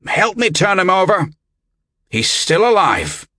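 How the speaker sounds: high-pitched urgent shout; urgent yell. A narrator's reading. Albert finding the body alive, yelling to others. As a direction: call the others to help at once. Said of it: "he yelled to the others".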